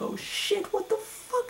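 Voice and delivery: very high frightened gasp of words; cowering squad members voiced in fright; mock terrified squeak. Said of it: "They're just like".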